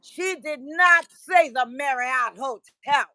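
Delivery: high, loud, slow indignant lecture; mock his angry scolding; imitating an angry man. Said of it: "He said".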